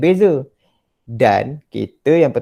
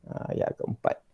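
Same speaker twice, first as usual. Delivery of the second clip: normal talking voice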